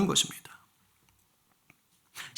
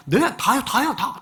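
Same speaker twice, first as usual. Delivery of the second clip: mock liar; 6 semitones higher than usual. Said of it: "베드로 묻잖아".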